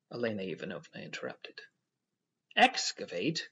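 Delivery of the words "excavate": cried out. A narrator's reading. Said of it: "cried the proprietor".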